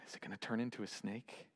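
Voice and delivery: softly acted, low nervous question; apprehensive hush. Aaron waits after throwing down the staff, apprehensive hush. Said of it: "it takes a deep breath".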